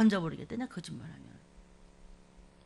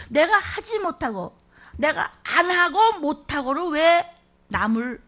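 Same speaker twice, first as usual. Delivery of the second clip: scolding; 4 semitones higher, 6 dB louder, slower than usual. Real, not acted. Preacher scolding the congregation.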